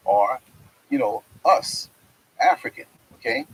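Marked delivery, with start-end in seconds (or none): none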